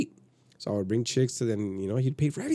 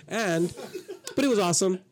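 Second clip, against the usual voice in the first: laughing; 8 semitones higher than usual. Real, not acted. Laughs at his own story.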